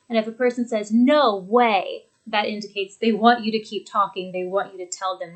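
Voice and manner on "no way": loud, drawn-out surprise; amazed exclamation, leaning on both words; astonished. Shown as a demonstration. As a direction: react in astonishment, inviting more. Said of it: "They're indicating their surprise".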